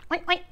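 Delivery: high playful oinks; two light piggy oinks. Acted. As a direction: oink like a little pig. Pig sound in story.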